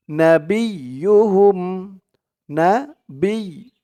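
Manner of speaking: نبيهم spelled slowly, syllable by syllable. Shown as a demonstration. Teacher spelling out the word slowly. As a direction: break نبيهم into slow syllables. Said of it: "বা দিয়ে ইয়া ধরবে".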